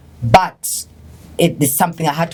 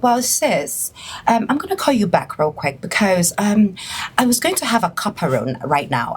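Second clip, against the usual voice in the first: lightly put-on English accent, a bit higher; British accent impression; 3 semitones higher than usual. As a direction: play the polite English girl ending a call. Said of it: "She talks like this".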